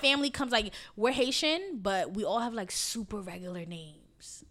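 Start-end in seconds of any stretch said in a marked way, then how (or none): 2.5-4.4 slow whisper; whispered aside. Real, not acted. Telling the cohost about plain family names, in a slow whisper. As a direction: lean in and whisper it like a secret.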